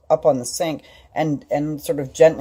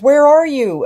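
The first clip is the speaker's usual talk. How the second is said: high, loud searching call; 12 semitones higher, 9 dB louder, slower than usual. Retold or imitated. Husband rushing in, calling to find her, loud.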